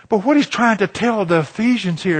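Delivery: ordinary delivery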